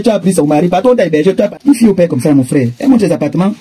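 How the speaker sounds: usual speaking voice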